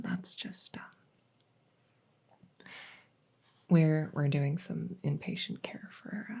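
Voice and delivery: breathy ASMR whisper, a quick aside; hushed whispered aside to the patient. Acted. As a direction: whisper it so the cat isn't disturbed. Whispered aside about the cat.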